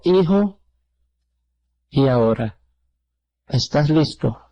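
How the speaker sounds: low, gentle fatherly question; sweet voice. Retold or imitated. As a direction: imitate God asking tenderly, very low, with pauses. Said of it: "con una voz tan dulce". (voz muy dulce, baja y pausada)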